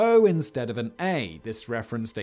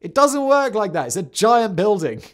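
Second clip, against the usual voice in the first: exasperated shouting; 9 semitones higher, 9 dB louder than usual. Retold or imitated. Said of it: "they'd be like".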